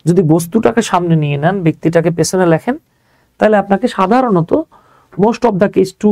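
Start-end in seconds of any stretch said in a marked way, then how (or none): none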